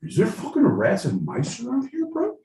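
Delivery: high, incredulous dude question; California dude contestant. Acted. Contestant asks host about rats and mice, baffled high dude voice. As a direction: squeak it up like an alarmed bro. California Steve reacts to phrase.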